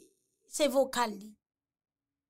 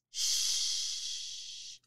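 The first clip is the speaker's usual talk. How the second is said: soft shush; 6 dB quieter than usual. Acted. Spa scene, calming sounds.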